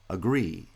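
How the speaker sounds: "agree" with pitch rising onto the second syllable; stress on final syllable. Shown as a demonstration. Lecturer giving an example verb, final stress on "agree". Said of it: "verbs often have final stress".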